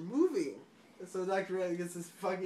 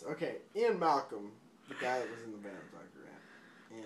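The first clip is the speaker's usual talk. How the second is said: ordinary talk, nothing marked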